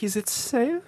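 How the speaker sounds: high, soft sinister German whisper; menacing quiet question; creepy Marathon Man villain. Retold or imitated. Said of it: "harkens back to, uh, Marathon Man".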